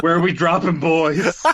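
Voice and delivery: rally the squad like a streamer; gamer bro call. Retold or imitated. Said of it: "he flosses right out of the airplane".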